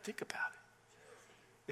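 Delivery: breathy hushed aside, very soft and slow; whispered aside to the congregation. Real, not acted. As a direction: drop to a whisper to make them think.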